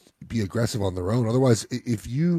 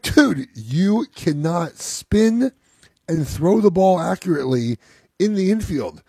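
higher and very slow, every word spelled out; emphatic dad lecture; 8 semitones higher, 5 dB louder, slower than usual. Retold or imitated. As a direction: lay it down slowly like a dad who knows better. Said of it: "I'm like".

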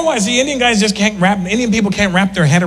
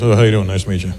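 much lower, easy greeting; 9 semitones lower, faster than usual. Retold or imitated.

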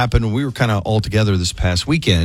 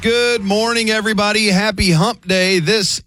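high, slow morning-show announcer; big on-air greeting; radio DJ voice; 11 semitones higher, slower than usual. Acted.

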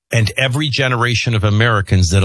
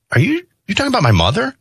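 higher, faster incredulous question; retold disbelief; 11 semitones higher, much faster than usual. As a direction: ask it like you can't believe your ears. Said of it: "I interrupted and I said".

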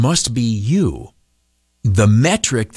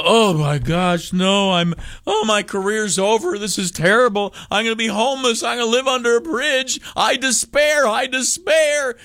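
much higher, hammed-up wailing despair; mock despair; 10 semitones higher than usual. Panicking over bad ratings.

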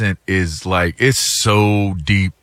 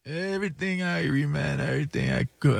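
soft, higher mock Jamaican accent; imitating a Jamaican accent; 4 semitones higher, 11 dB quieter than usual. Pilot keeps chatting, soft higher put-on Jamaican accent. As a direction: drop to a soft, high Jamaican lilt.

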